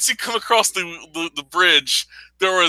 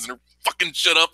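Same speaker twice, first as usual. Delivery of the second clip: ordinary talk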